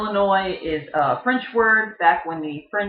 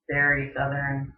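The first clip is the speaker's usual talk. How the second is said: normal talking voice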